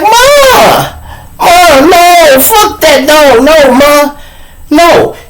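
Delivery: high, loud wail for Ma; panicked boy. Acted. Little boy being cuffed calls his mother, panicked wailing. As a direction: plead shrilly, stretching the cries out. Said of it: "The little boy had started crying".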